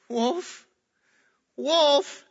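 high, rising calls for help; boy crying wolf. Acted. Little boy calling townspeople to the wolf, high alarmed cry. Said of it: "so the little boy says".

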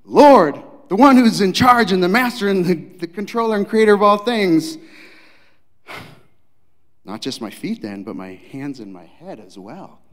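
high, loud, rushing enthusiasm; eager Peter. Acted. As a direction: overeager plea. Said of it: "quickly pivots and says".

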